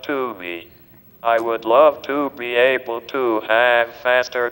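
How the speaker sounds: synthetic text-to-speech voice; digitized voice. Real, not acted. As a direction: speak like a computer speech device. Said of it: "this digitized voice just does not seem to quite".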